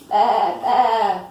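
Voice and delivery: loud, drawn-out baa; sheep's bleat. A narrator's reading. Reader voices the sheep in a picture book, bleating loudly. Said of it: "bleated the sheep".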